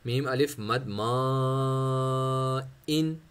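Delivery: मा drawn out long and slow; stretched madd. Shown as a demonstration. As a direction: hold the madd on मा for a long count. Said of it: "इतने हद तक खींचना है".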